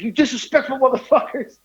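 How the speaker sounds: high-pitched mock scolding; retold angry outburst. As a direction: act out an indignant driver cursing.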